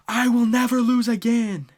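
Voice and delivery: high, loud cried-out vow; impassioned vow. Retold or imitated. Zoro vowing to his captain after losing, impassioned shout. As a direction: imitate a defeated swordsman shouting his oath.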